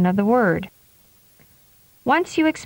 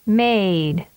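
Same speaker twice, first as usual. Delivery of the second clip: "Made." with the vowel held long; drawn-out "Made.". Shown as a demonstration. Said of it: "and then with a longer duration".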